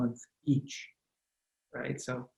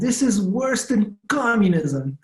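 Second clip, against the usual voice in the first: imitating mom's Eastern European accent, higher and slow; slow, higher Eastern European accent, mocking mom's dismay; 7 semitones higher, slower than usual. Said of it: "in her Eastern European accent".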